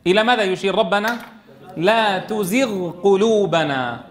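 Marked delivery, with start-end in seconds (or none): 1.8-4.0 slow "لا تزغ قلوبنا" with long vowels; clear unmerged ghayn. Shown as a demonstration. Teacher reciting the verse hinted at, letters distinct. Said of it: "فلا يدغمان".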